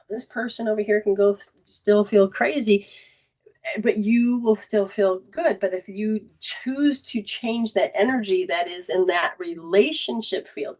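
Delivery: ordinary talk, nothing marked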